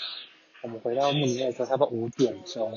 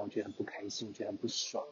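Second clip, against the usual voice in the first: quietly annoyed; 3 semitones lower, 10 dB quieter, faster than usual. Real, not acted.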